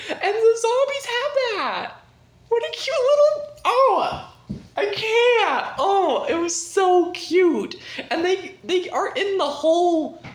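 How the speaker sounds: high, loud squealing delight; overcome gushing. Real, not acted. Fan overcome by zombies parenting their children, squealing. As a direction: squeal as if too cute to bear.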